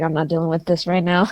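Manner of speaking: fed up. Acted. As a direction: sigh it off, unimpressed. Camilla fed up, shutting door.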